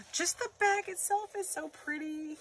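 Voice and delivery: high, soft gasping admiration; gasp, then coo it softly up high; awed gasp. Real, not acted. Awed at the gift bag.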